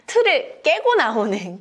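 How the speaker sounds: plain reading voice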